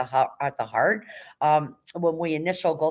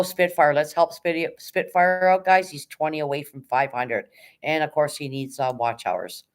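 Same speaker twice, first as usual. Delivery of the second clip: ordinary delivery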